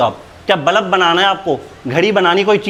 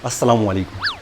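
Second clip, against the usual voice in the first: suddenly soft and lower, overly courteous; mock polite; 6 semitones lower, 8 dB quieter than usual. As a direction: play the humble gentleman, hands folded. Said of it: "नाम बताया, हाथ बांधे, बैठ गया". (नकली अदब से, धीमी नरम आवाज़ में)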